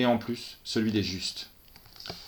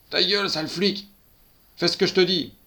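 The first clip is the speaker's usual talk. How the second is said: loud, higher, snarling threat; thug's angry shout; 8 semitones higher, 6 dB louder than usual. A narrator's reading. Gunman snarls at the policewoman, angry shout. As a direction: spit the insult at the cop.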